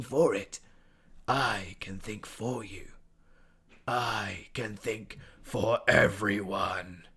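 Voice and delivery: slow, airy, menacing whisper; a creature's voice coiling inside a cat's head; sinister Spider whisper. A narrator's reading. Spider voice held.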